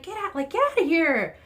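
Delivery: high, loud get out of here; mock shooing. Vlogger retelling shooing off runners, high loud mock shout. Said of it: "And I'm like".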